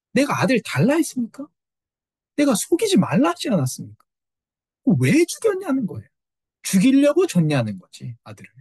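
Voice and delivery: a grieving mother's high, pleading accusation; anguished reproach. Retold or imitated. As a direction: cry out why he gave her a son to lose. Grieving mother confronts prophet.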